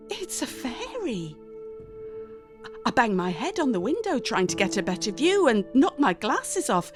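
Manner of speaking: usual speaking voice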